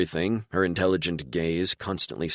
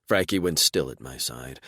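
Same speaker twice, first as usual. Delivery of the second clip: usual speaking voice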